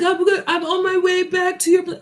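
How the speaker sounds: lightly mocking drunk voice; mock drunk caller. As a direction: toss it off like a careless drunk.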